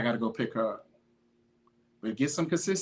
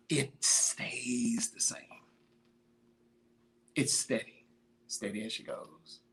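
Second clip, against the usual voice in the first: slow, hushed whisper; sudden whisper; 7 dB quieter, slower than usual. Real, not acted. Coach to viewers, quietly stressing steadiness, whispered. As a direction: drop to a secret whisper to drive it home. Hushed emphasis after a loud run.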